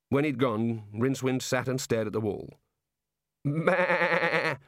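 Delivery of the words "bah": high, wavering sheep's baa; sheep bleat. Acted.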